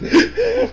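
short, high giggle. Real, not acted. Fan giggling over best girl Charlotte, high giggle. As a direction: giggle fondly. Gushing about favorite character.

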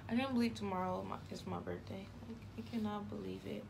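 ordinary talk

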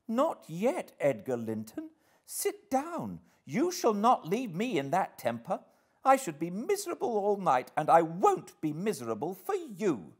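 high-pitched, imperious insistence; petulant girl laying down the law; insistent. A narrator's reading. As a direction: order him to stay, headstrong and high. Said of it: "she persisted".

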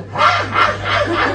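high, voiceless burst of cackling; imitated sleep laughter. Daughter imitating mother laughing in her sleep, high cackle. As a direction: imitate a sudden midnight cackle. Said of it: "mẹ ngủ nửa đêm cái mẹ cười".

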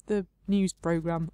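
usual speaking voice

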